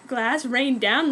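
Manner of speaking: ordinary delivery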